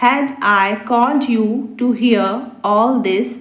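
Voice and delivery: slow, measured, each word separate; slow repeat. Shown as a demonstration. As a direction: repeat the question slowly for learners. Repeats translation slowly for learners. (धीरे-धीरे, शब्द अलग करके)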